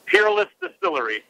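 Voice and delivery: "Peerless" with a full American r before the L. Shown as a demonstration. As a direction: say "Peerless" with a clearly sounded R. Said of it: "Can you say it?".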